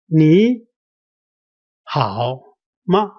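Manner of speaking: word by word, lift "你" and drop "好" before "吗"; practice demonstration. Shown as a demonstration. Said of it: "let's keep trusting, uh, keep, uh, practicing".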